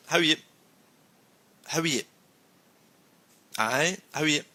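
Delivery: northern English or Scottish accent. Shown as a demonstration. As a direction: say "Aye, how are you?" the northern way, ye for you. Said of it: "you hear".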